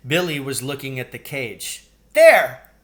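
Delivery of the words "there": much higher, loud shout; excited cry. A narrator's reading. Billy shouting at the table as the mouse walks, excited. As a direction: cry out in triumph. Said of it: "he cried".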